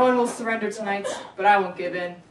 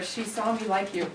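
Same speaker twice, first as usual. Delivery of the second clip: normal talking voice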